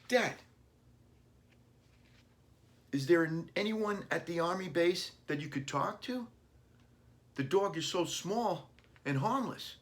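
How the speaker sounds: put-on high child's voice, pleading; young son protesting to his dad, high and pleading; little boy. A narrator's reading. Billy voiced by reader.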